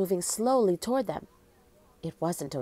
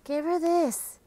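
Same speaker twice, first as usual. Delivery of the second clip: plain reading voice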